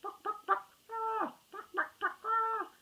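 high clucking chicken noises. Acted. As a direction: cluck like a strutting chicken to mock him. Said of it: "flapping his arms like a chicken and clucking".

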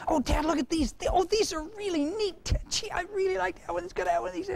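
put-on very high, pleading kid voice; begging boy. Boy pleading with his dad, very high and wheedling.

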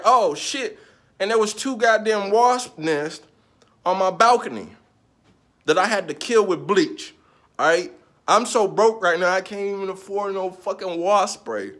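loud, high, fed-up complaining; venting at full volume about the broken blinds; exasperated rant. Real, not acted. To viewers about his apartment, loud exasperated ranting.

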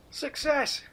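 excited. Real, not acted. Lid finally comes off tin.

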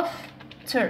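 "laughter" slowly, as laugh and ter; split into syllables. Shown as a demonstration. Teacher recapping the syllable count, "laughter" split in two. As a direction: break "laughter" into two clear beats. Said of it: "عندها two syllables".

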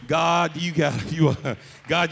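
ordinary talk, nothing marked

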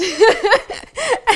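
loud high burst of laughter; excited high laugh. Real, not acted. Telling the interviewer about posting, a loud high laugh. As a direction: laugh out the relief. Laughing after hitting send.

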